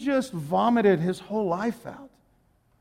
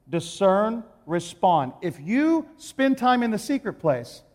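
ordinary talk, nothing marked

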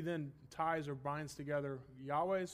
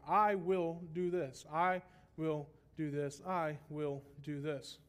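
slow, weighty "I will do this", each word set apart; emphatic repetition; slower than usual. Shown as a demonstration. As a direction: stretch "I" and "will" deliberately in every repeat. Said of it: "the emphasis is on what he is doing".

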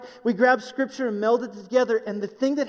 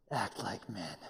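much lower and softer, pressing it home; low, quiet charge; 13 semitones lower, 14 dB quieter, slower than usual. Shown as a demonstration. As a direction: say it low and close to the men. Charging the men directly.

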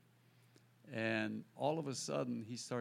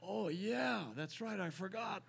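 sheepish realization; 8 semitones higher than usual. Retold or imitated. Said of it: "And I go".

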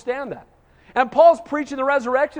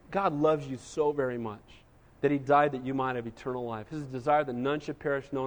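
lower, much softer, tender invitation; gentle loving appeal; 8 semitones lower, 7 dB quieter than usual. Shown as a demonstration. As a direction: speak to them softly, as a fellow sinner. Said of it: "a little different message than standing on a box and screaming".

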